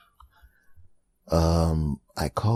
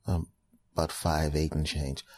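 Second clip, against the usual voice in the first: hesitant caller; 3 semitones lower, 7 dB quieter than usual. Pretend. Caller voiced quietly.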